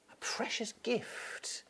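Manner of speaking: whisper. Real, not acted. Tender aside in a sermon.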